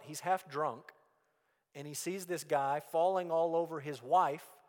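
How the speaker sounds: normal talking voice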